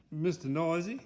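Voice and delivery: ordinary delivery, nothing marked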